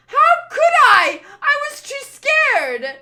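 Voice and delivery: very high, loud, drawn-out protest; hysterical, stretched-out yelling; scared wail. Acted. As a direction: cry out in helpless fear. Defends himself in a panic.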